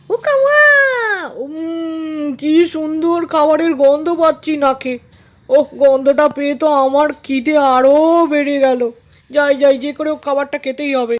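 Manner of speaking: drool over the smell of food; hammed-up hungry jackal relish; greedy jackal, delighted.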